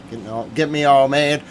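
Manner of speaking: ordinary delivery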